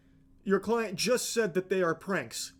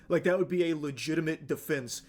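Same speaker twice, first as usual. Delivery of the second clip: usual speaking voice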